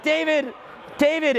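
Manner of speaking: high mock shout of a name; heckler calling out. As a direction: call out in a caricatured high voice. Imitated student calling from crowd.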